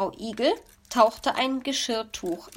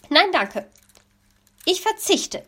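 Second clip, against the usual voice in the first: higher, louder curt refusal; louder, higher offended snap; hissed refusal; 9 semitones higher, 4 dB louder than usual. Acted. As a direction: snub the offer sharply. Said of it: "zischte die Elster".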